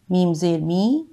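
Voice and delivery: usual speaking voice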